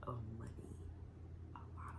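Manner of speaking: hushed whisper for emphasis. Acted. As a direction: whisper it like a secret. Stage-whispered emphasis on savings.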